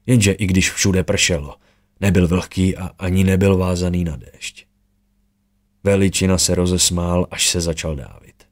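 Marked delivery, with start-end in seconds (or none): none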